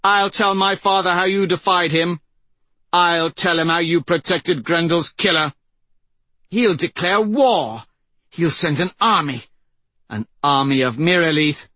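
high, threatening royal voice; vengeful prince. Acted. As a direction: spit out threats of war.

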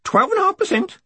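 very high parrot screech; piercingly high bird voice, screamed; screaming cockatoo. A narrator's reading. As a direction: squawk it like a talking cockatoo. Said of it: "screamed the cockatoo".

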